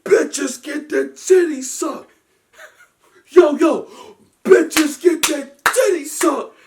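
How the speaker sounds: high, slow, loud and laughing; laughing repeat. Retold or imitated. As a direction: repeat the lyric in laughing disbelief. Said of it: "I said".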